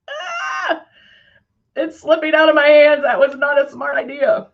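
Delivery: ordinary talk, nothing marked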